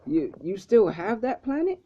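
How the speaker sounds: mock disbelief at the planet; retold freak-out. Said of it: "Vegeta start, like, freaking out".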